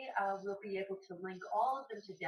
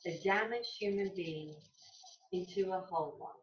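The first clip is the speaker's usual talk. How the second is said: measured reading with deliberate pauses; reading aloud; 5 dB louder than usual. Acted. Yoga teacher reading a short passage to the class, measured recitation. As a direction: read it out clearly, phrase by phrase.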